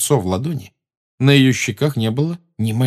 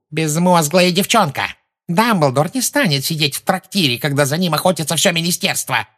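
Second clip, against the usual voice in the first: shouting; 8 semitones higher than usual. A narrator's reading. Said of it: "закричала Амбридж".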